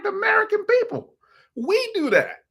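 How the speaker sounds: very high, emphatic reply; exasperated exclamation. Retold or imitated. Husband answering his wife's question, exasperated. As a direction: pitch leaps up to hammer the point.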